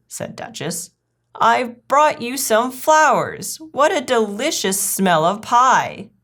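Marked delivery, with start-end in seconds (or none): 1.3-6.1 high, drawn-out delight; effusive, singsong party manners; gushing guest. Acted. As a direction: gush politely over the hostess's treat. Duchess gushes on arriving.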